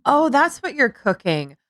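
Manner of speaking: acting out blurting it. Cook's housemate blurting on walking in, re-enacted. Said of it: "I walked in and I'm like".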